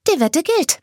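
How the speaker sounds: high, perky voice; Anna accepting the bet. Acted. Anna accepting Kane's bet, high and perky. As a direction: seal the bet brightly.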